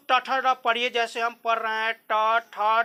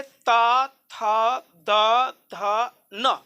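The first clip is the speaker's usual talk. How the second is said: very slow, each letter held apart; slow letter-by-letter demonstration; slow demo recitation; 3 semitones lower, much slower than usual. Shown as a demonstration. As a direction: sound out each letter slowly. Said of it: "तथ द आप पढ़िए".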